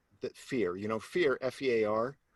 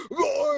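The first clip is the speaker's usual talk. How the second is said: high, loud acted yell; falling scream; 5 dB louder than usual. Jumper in roller-coaster free fall, falling scream.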